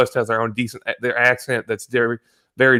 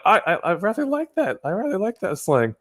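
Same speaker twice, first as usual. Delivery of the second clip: mock delight; 9 semitones higher than usual. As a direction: lilt it high, savouring the slang. Acted delighted reaction to slang.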